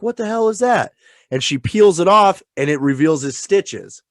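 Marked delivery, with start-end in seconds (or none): 0.0-0.9 higher-pitched puzzled demand; retold reaction of alarmed puzzlement; startled question. Retelling the woman finding something on Chucky's face, mock startled. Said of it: "and she's like".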